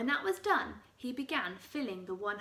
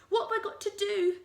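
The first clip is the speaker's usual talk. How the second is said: high, fast frantic question; frantic Mrs Twit; 10 semitones higher, 8 dB louder, faster than usual. Acted. Wife clutching her cheeks, high and frantic. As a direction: ask high and fast in panic. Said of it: "clutching her cheeks".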